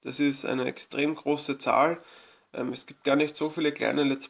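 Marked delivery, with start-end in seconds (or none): none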